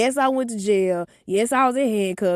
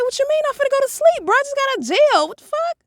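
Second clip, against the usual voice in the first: high, louder whiny protest; outraged teen; 5 dB louder, faster than usual. Retold or imitated. Daughter protesting to mom, high whiny outrage. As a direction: squeal it, indignant and tired. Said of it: "I said".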